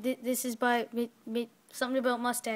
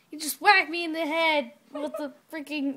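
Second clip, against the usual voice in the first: usual speaking voice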